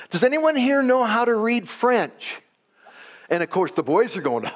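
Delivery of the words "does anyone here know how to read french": high, sustained call for volunteers; retold calling out, pitched well up. Blind old lady to schoolboys leaving school, calling out. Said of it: "she would kinda call out".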